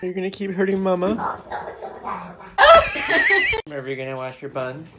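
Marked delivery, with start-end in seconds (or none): 1.2-3.6 high shrieking laugh. Real, not acted. Owner laughing at her cat, high shriek. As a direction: burst out in a loud shriek of laughter.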